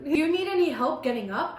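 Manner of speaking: ordinary delivery, nothing marked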